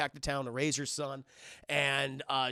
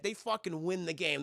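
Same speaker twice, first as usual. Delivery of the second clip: ordinary delivery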